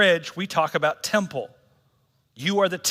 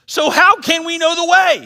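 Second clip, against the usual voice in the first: frustrated Thomas; 12 semitones higher, 10 dB louder than usual. Retold or imitated. Thomas's frustrated question retold.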